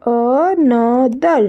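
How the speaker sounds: another stretched into "a... no... ther"; split into syllables. Shown as a demonstration. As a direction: say another very slowly, one syllable at a time. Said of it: "Three syllable". (another को तीन हिस्सों में तोड़कर, बहुत धीरे)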